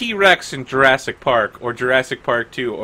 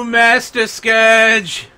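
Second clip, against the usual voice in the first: high, drawn-out awed question; starstruck recognition; 7 semitones higher, 4 dB louder, much slower than usual. Acted. Guard recognizing a famous wanted pirate, high slow astonishment. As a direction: stretch it out in rising amazement.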